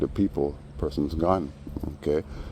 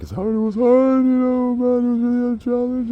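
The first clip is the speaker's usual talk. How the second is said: very high falsetto whine, much louder; mock fake crying; 6 dB louder than usual. Teller mimics the man acting like he's crying, hammed-up falsetto. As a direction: ham up a fake weepy lament. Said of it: "acting like he's crying".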